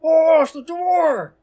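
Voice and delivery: high, loud panicked yell; desperate shout. A narrator's reading. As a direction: shout it through the vault door. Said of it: "they continue to shout fruitlessly".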